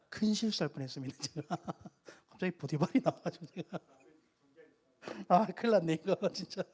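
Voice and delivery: laughing through the words at own slip; embarrassed laughing. Real, not acted. Preacher to congregation after mixing up Bible names, laughing in embarrassment. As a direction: chuckle helplessly while admitting a blunder.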